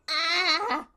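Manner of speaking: sharp loud yelp of pain. Real, not acted. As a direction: cry out from a burnt throat. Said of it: "I scalded my throat".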